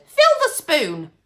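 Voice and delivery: put-on high, loud old crone bark; shrill old grandmother snapping an order; grandma's order. A narrator's reading. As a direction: command it like a nasty old hag. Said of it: "the old woman said".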